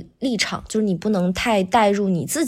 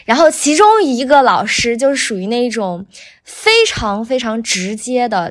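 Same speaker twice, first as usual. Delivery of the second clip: ordinary talk, nothing marked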